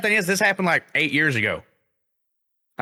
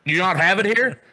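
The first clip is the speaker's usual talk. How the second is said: pissed off; 5 semitones higher than usual. Retold or imitated. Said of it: "I'm super pissed off".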